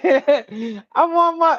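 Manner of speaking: high, slow drawn-out whine; whiny grandma. Retold or imitated. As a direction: stretch it out like a needy old lady. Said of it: "She'd be like".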